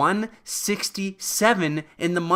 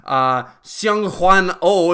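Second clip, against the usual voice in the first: ordinary talk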